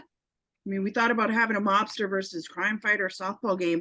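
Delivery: ordinary delivery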